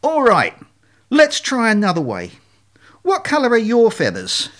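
exasperated swan leader, emphatic; out of patience. Acted. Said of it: "The lead swan finally lost patience".

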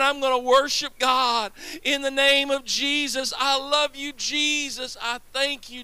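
ordinary delivery

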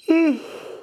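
long yawning exhale; big yawn. Real, not acted. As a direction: let out a big real yawn. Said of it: "I gotta yawn".

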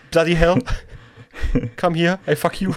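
a hammed-up angry British bloke accent.

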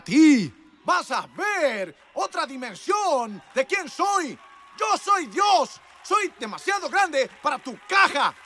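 high, loud and slow shouted proclamation; full-force preaching. Acted. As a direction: thunder out God's words to the crowd.

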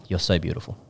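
low, soft imitated gushing comment; understated retelling of a girl's compliment; mock compliment. Imitating a girl commenting on a friend's photo, low and soft. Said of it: "the girls have commented and they've been like".

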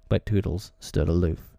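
ordinary delivery

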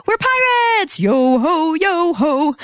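high, singsong mock-pirate chant; playful pirate cheer. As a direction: play pirates with silly high-pitched glee. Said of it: "they were just kind of like".

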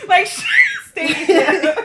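loud, laughing repeat of a joke; laughing shout. Real, not acted. Friends joking, loud laughter.

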